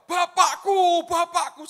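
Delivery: loud, high call to a father; crying out. Retold or imitated. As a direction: call after him as he is taken up. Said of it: "Ngomongnya apa?".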